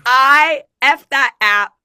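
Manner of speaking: loud, slow exasperated blurt; annoyed outburst. Real, not acted. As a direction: vent it, loud and drawn out. Fuming about typing test app.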